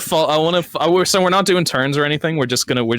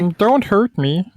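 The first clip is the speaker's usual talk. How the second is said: high, slow croaky frog; 4 semitones higher, slower than usual. Acted. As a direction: do a frog creature. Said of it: "Can we get a frog voice?".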